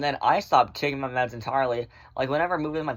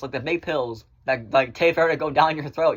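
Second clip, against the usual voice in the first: ordinary delivery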